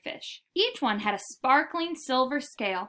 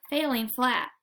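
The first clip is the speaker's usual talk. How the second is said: usual speaking voice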